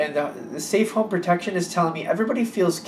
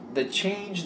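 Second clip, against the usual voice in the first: ordinary talk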